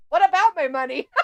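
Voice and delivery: amused bafflement. Retold or imitated. Retold baffled victim, laughing at it.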